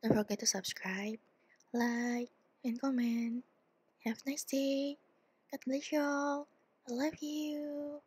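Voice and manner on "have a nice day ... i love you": soft ASMR whisper, higher and slow. Acted. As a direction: hush a tender goodbye. Whispered sign-off.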